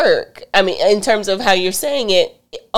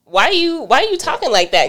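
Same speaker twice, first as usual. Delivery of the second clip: mock offended; 8 semitones higher than usual. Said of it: "I'd be like".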